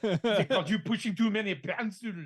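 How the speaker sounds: Austrian strongman attempting French; hammed-up Austrian-French accent; Arnold Schwarzenegger. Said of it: "do Arnie doing a French accent".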